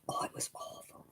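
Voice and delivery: breathy whisper of disgust. Real, not acted. A whisper to the listener about the awful coffee. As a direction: whisper it, still put off by the taste. Recalling terrible Paris coffee.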